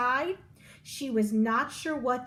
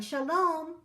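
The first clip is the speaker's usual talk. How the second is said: a mildly called-out farewell, higher; mild shout; 7 semitones higher than usual. A narrator's reading. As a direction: call goodbye across to the bus.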